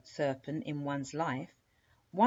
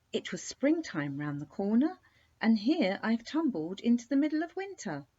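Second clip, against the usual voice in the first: put-on slightly higher, bright girlish princess, surprised; a young princess puzzled by the sudden winter; lightly voiced princess; 6 semitones higher, 4 dB louder than usual. Princess to the skate, lightly surprised.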